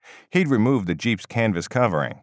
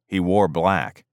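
ordinary delivery

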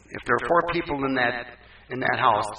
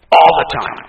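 loud echo; 11 dB louder than usual. Real, not acted. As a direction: repeat "All" firmly.

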